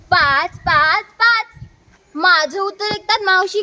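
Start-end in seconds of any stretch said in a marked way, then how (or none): 0.1-1.4 loud chant climbing in pitch; shouted answer. Acted. Child shouting the answer to aunt's easy sum. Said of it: "मी ओरडलो".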